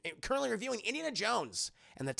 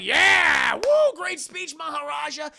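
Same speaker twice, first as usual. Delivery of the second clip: mock cheering crowd; 9 semitones higher, 9 dB louder, slower than usual.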